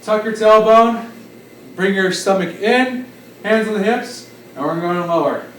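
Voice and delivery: usual speaking voice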